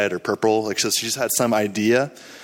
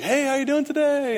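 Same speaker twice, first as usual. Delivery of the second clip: high singsong call to someone. Retold or imitated. Visitor hails a lady some distance away, calling out. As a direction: call out a friendly hello, pitched high. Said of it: "I just called out to her".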